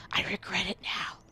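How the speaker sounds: hushed scared whisper. Real, not acted. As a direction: whisper it to yourself. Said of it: "I'm so scared".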